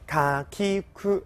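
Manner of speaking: counting kana slowly, one by one. Shown as a demonstration. Teacher counting kana for students, slow. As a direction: spell out each sound slowly. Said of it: "我们数一下".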